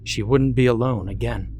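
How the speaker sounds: ordinary delivery, nothing marked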